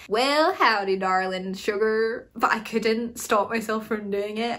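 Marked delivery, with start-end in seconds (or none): 0.1-2.3 hammed-up Southern cowboy greeting; mock Southern accent, punchy opening. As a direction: put on a playful Southern twang. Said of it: "southern accent".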